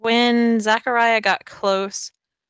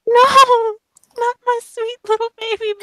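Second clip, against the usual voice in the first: put-on very high, babying cry of protest; mock-distraught wail; 4 dB louder than usual. Player protesting about their own sweet character, mock-distraught wail. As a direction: wail like a doting, scandalised parent.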